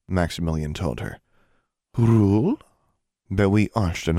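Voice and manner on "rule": puzzled question. A narrator's reading. Puzzled stranger questions word.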